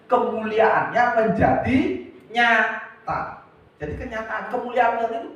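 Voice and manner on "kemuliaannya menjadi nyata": louder, slowed, higher on menjadi; emphatic repeat. Acted. Preacher repeats the promise, slow and loud. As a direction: hammer the phrase home.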